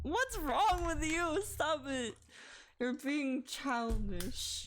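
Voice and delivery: put-on high, slow baby-talk scolding. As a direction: scold the cat like a toddler. Scolding cat fighting sleep.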